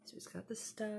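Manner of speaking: soft hushed commentary; whispered aside. Real, not acted.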